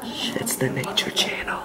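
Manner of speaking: breathy nature show whisper; whispering documentary host. Pretend. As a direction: whisper like a wildlife narrator.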